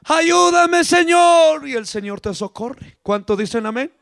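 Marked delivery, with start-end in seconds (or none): 0.1-1.7 high, raised plea for help; crying out. Acted. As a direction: call on the Lord for rescue. Acts a believer's plea to God.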